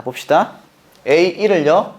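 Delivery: usual speaking voice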